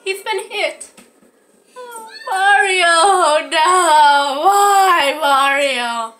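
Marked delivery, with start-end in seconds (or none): 2.3-6.1 drawn-out wail, sinking at the end; hammed-up grief for a fallen hero; mock wail. Player mourning Mario, mock wail.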